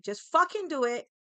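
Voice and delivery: retold exasperated outburst, loud and high; exasperated order. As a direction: punch out an impatient command.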